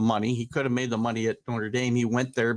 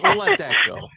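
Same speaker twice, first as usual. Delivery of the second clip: high laughing burst over the words; 7 dB louder than usual. Real, not acted. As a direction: laugh while brushing it off. Laughing at co-host's jab.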